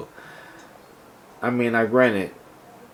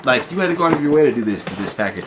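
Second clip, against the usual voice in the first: exasperated rant; 3 semitones higher, faster than usual. Real, not acted. Ranting at the damaged package.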